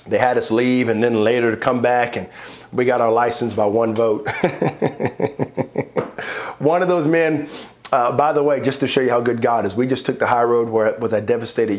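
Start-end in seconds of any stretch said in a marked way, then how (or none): 4.3-6.5 long, breathy run of laughter. Real, not acted. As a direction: laugh it off after the story. Laughs after one-vote license.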